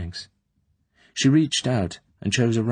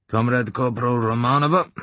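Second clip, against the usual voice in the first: higher, louder formal address; voice of authority; 5 semitones higher, 4 dB louder than usual. A narrator's reading. Colonel summoning the corporal, authoritative. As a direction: call her name like a senior officer. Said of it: "it was the voice of authority".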